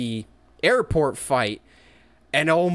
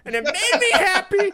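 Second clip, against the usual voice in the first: laughing; 5 dB louder than usual. Real, not acted. Laughs recalling scene.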